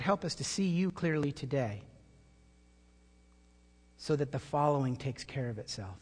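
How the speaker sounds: soft, hushed prayer voice. Acted. Pastor praying softly before the congregation, hushed. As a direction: pray quietly to the Lord.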